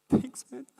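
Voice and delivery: high, loud burst of gratitude; overjoyed reply, acted out with a laugh; delighted thanks. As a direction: squeal thanks at an unexpected compliment. Acts delighted reply to first compliment.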